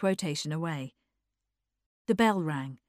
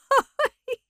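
high society-lady laugh; acted laugh; 8 dB louder than usual. Norman's wife joining in the laughter, high laugh. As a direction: burst into a high, light laugh. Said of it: "joined in the laughter".

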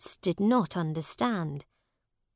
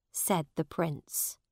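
ordinary delivery

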